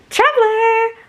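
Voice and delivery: very high, loud singsong shout; excited call. A narrator's reading. Friend calls from a bathroom stall, high and excited. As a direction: squeal out a friend's name. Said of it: "you heard a voice coming out from the bathroom stall".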